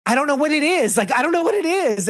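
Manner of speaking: high whiny mock of a clueless partner. Mocking the couple's going-nowhere fight, high whiny mock. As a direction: pitch way up and whine helplessly. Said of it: "But instead it's this".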